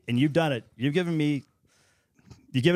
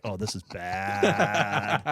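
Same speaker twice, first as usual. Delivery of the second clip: laughing dread; 4 semitones lower, much slower than usual. Retold or imitated. Reacting inside the story.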